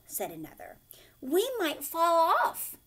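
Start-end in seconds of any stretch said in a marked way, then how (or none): 1.2-2.8 louder, higher and a bit slow, worried cry; frightened kids calling out as one; crying together. Acted. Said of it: "they all cried together".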